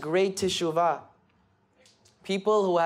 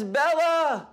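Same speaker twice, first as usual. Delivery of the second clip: joyful cry; 10 semitones higher than usual. A narrator's reading. Wife sees her husband after thirty-five years, crying out his name joyfully. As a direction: call his name in overjoyed shock.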